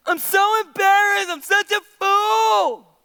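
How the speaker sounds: high, drawn-out mock wail; wailing Delilah. Preacher plays Delilah shamed by gossip, high mock wail. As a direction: ham up the embarrassment, pitched high.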